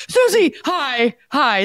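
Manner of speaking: high, slow, nervous loud hello; eager shout of relief at a familiar face; too-loud greeting. Retold or imitated. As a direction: overdo the greeting from nerves. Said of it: "I probably yelled a little too loud".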